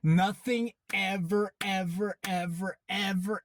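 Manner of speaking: exasperated, each word set apart; heated. Real, not acted. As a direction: hammer out every repeated word in frustration. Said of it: "he has me heated".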